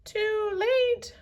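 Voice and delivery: high, shrill-free parrot croak; parrot squawk. Acted. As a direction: squawk it like a bird. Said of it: "squawked Polly".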